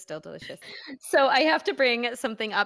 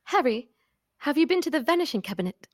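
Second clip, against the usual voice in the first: light mock British accent, quick and a bit higher; imitating the movie's British "vanishing cabinet"; a hint of a British wizard asking about the "vanishing cabinet"; British accent impression; 5 semitones higher, faster than usual. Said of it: "So they're like, let me see if I can do it".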